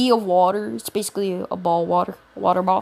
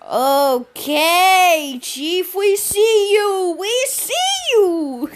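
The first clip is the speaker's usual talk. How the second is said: much higher, slow hype chant; hyped classmates; 9 semitones higher, 3 dB louder, much slower than usual. Retold or imitated. Classmates cheering the huge throw, high and drawn out. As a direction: stretch it out like cheering a friend. Classmates hyping in retold story.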